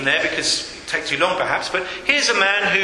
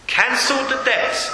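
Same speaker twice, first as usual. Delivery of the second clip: ordinary delivery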